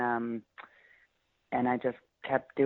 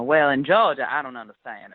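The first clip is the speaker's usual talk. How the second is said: sweet Georgia accent; 6 dB louder than usual. Shown as a demonstration. Imitating a debutante in a sweet Georgia accent. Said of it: "that sort of accent".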